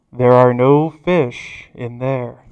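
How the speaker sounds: low, slow, loud boom; drawn-out, booming God voice, low and weighty; booming voice from heaven. Acted. Voice from the heavens warning the fisherman, slow boom. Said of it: "the big booming voice comes".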